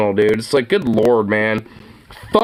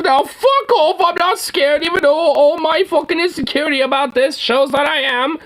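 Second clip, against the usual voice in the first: mock old hag; 12 semitones higher than usual. Mocking the woman in the video, high and sneering. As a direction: lampoon her in a raised mocking voice. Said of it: "It's like, fucking old hag".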